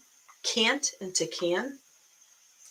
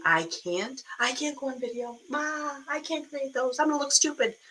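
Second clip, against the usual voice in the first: softer, higher mock whine; self-doubting whine; 5 semitones higher, 4 dB quieter than usual. Coach voicing the viewer's excuses, mock whiny self-doubt. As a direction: mimic someone's anxious self-doubt. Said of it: "You're saying".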